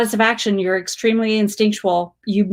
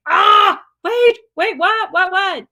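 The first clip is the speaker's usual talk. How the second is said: high, rapid stunned wait what; squealing double-take; excited surprise; 11 semitones higher, 3 dB louder, slower than usual. Real, not acted. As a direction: gasp out the disbelief. Reacting to a viewer's news.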